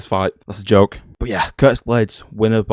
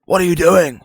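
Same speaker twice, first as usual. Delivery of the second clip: loud outburst; 9 semitones higher, 7 dB louder than usual. Real, not acted. Outraged at the fight booking.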